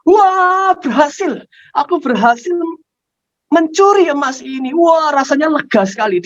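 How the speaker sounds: high-pitched jubilant cry; excited shouting. Acted. Child hiding in the bathroom after the heist, shouting excitedly. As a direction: cheer like a triumphant kid. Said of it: "si anak ini langsung teriak-teriak".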